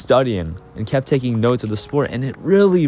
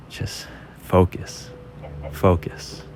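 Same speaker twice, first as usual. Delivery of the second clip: whisper; 5 semitones lower, 6 dB quieter, much slower than usual. Retold or imitated. Self-talk before putt.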